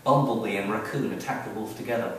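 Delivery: plain reading voice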